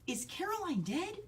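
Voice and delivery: put-on high, slow, small child asking; little girl voice. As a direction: ask slowly like a frightened four-year-old. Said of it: "And she goes".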